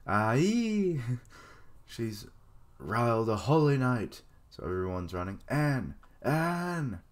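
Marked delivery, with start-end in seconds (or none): 0.1-1.0 high, loud mock shriek; sudden high-pitched acted cry; mock scream. Character cries out as the tower collapses, mock scream. 5.5-7.0 raised, high-pitched acted call. Searching for Anne while everyone's running, acted calling out.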